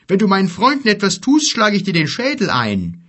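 fast, loud, high-pitched threat; threatening. Acted. Giant warning the bear about his friend, threatening. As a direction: rattle off the threat with force. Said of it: "sagte er drohend".

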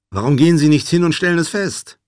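loud, high retort to a companion; pointed retort. Acted. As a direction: throw the question back loudly. Retort to companion's nervous questions.

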